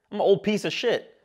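usual speaking voice

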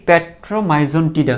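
petromyzon tida spaced out very slowly; teacher leading a repeat-after-me drill; slow pronunciation. Shown as a demonstration. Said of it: "আমার সাথে সাথে করে একবার দুইবার একটু উচ্চারণ করে ফেলো".

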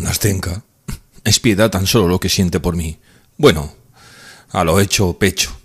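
ordinary delivery, nothing marked

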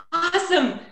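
ordinary talk

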